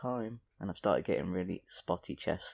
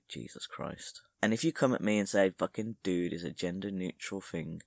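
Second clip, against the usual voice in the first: ordinary talk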